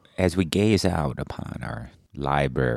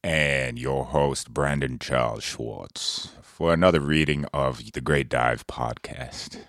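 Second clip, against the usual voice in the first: deep announcer; 4 semitones lower, 5 dB quieter, slower than usual. Pretend. Formal podcast intro.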